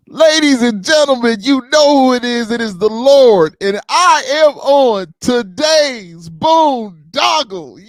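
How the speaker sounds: big booming hype-man intro; wrestling promo. Shown as a demonstration. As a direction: announce yourself like a wrestler cutting a promo. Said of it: "you mind cutting a promo I D for the show?".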